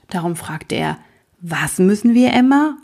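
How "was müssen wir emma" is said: rising to a loud, high "Emma?"; can't believe his ears; shocked disbelief. Acted. Lukas shocked, asks the king loudly and high about Emma. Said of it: "er hätte nicht recht gehört".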